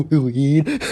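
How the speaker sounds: high, exaggerated fake laughing fit blurting out a word; badly faked, uncontrollable high giggles; fake hysterical laugh. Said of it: "the worst fake laugh of the century".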